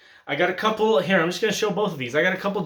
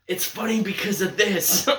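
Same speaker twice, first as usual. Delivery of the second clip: laughing explanation; 5 semitones higher than usual. Retold or imitated. Explaining jokes to nephew.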